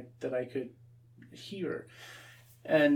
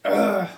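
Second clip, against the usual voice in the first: disgusted ugh; 8 semitones higher, 13 dB louder than usual. Retold or imitated. Ticked off at chore.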